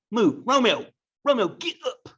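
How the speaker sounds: high, loud, panicky pleading; frantic. Acted. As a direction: shove him off with rising alarm. Juliet pushing dead Romeo off.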